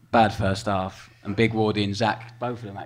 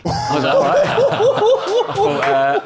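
high, loud laughter through the words; can't stop laughing while telling it; breaking up laughing mid-story; laughing hard; 12 dB louder than usual. Real, not acted. Laughing while retelling the gaffer.

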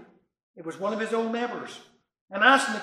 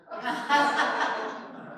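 soft laugh; 6 semitones higher, 4 dB quieter than usual. Real, not acted. Laughs after joke.

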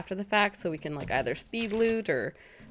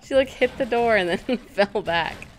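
higher voice broken by laughter; amused laughing; 5 semitones higher, 4 dB louder than usual. Real, not acted. Gamer to viewers about dog hitting door, laughing. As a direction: tell it while cracking up.

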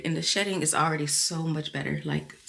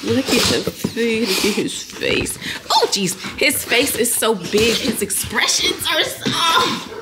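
loud, high-pitched gushing over a cute face; squealing up higher toward the end; gushing excitement; 4 semitones higher, 7 dB louder, slower than usual. Real, not acted. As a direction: draw the words out loudly, cooing with delight. Gushing over a little one's face.

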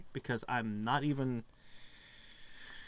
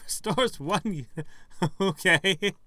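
laughing; 8 semitones higher, 7 dB louder, slower than usual. Real, not acted. Amused reading a game item.

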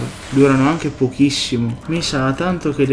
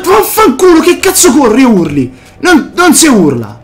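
loud, higher cursing at a ghost; angry scared outburst; 10 semitones higher, 7 dB louder than usual. Real, not acted. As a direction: swear at the thing chasing you. Ghost runs and screams at him.

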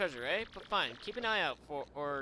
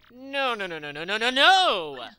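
louder and louder protesting nos; frantic no; 7 semitones higher, 14 dB louder than usual. Acted. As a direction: refuse in a rising panic. [name] protests wildly.

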